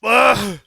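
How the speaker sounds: sudden loud gag; choking gag. Real, not acted. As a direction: gag on a bug. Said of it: "I swallowed a bug".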